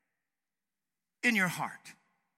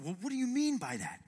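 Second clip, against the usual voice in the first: dumbfounded; 6 semitones higher, 5 dB quieter than usual. Acted. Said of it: "they look at him dumbfounded".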